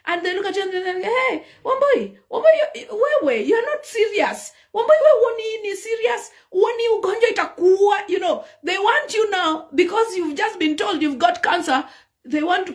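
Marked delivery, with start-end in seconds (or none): 1.0-8.1 friends scolding. Retold or imitated. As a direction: scold her for not being serious. Friends scolding cancer patient.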